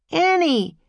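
high, loud call that falls. A narrator's reading. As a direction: call her name loudly into the distance. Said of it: "he called again".

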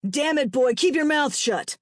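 loud, angry bark at his son; harsh fatherly rebuke. A narrator's reading. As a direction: bark an order, pitch and volume up. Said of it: "Gavin barked".

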